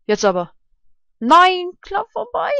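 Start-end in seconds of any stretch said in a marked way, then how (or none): 1.2-2.5 loud high no; frustrated shout. Real, not acted. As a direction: cry out at the miss. Near miss.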